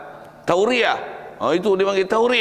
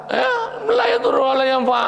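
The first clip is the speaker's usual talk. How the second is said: mock wail. Mock whine about useless idol.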